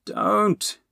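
sharp high cry of warning; urgent cry. A narrator's reading. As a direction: cry out to stop them. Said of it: "Dipple cried".

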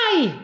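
high, sudden little cry of pain; sharp yelp of pain. Acted. Little Rex yelps when stung on the tail, high cry. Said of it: "gridò piccolo Rex".

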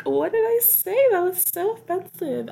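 high-pitched mock disbelief; acted-out bewildered defense; retold shocked protest. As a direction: squeal it like you can't believe the reaction. Retelling her shocked reply to Max.

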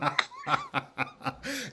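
a high delighted laugh; big surprised laugh at a friend's joke; high burst of laughter. Real, not acted. Host laughs at tease.

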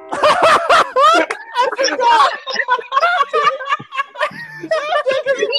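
high-pitched laughter through the words; can barely get it out for laughing; cracking up mid-story; laughing hard. Real, not acted. Laughing while telling story.

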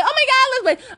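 high, exasperated imitation; mock angry. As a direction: mimic an annoyed friend's outburst. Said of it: "she was angry about some other shit".

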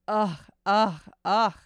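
loud rhythmic grunting; loud grunts. Acted. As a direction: grunt with each thrust. Thrust sounds in sex scene.

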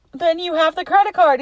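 high-pitched mock accusation; retold demand. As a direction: imitate an exasperated demand. Retold quarrel over credit card.

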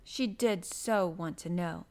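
ordinary delivery, nothing marked

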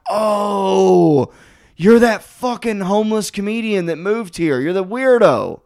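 high drawn-out realization; dawning recognition. Retold or imitated. Comic spots homeless comedian on stairs, mock realization. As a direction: pitch up as if it just clicked. Retold moment of recognising the man.

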